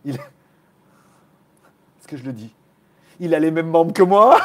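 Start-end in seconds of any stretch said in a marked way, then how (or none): 3.2-4.4 loud line through a laugh. Real, not acted. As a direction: blurt it out laughing. Laughing joke. (en riant)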